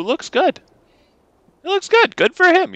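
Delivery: high camp cowboy voice. Acted. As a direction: sing out high like flamboyant Oko. Oko voiced flamboyantly.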